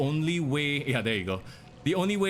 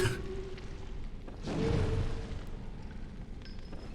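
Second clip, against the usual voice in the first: breathy laugh; 8 dB quieter than usual. Real, not acted. Unvoiced high laugh.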